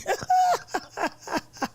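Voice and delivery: high giggling burst; high laugh. Real, not acted. Laughing at chat.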